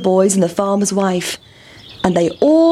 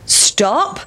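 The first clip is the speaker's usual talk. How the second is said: farmer calling stop; 7 semitones higher than usual. A narrator's reading. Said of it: "said the farmer".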